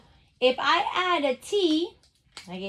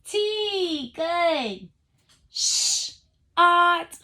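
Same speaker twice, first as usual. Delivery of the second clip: ordinary delivery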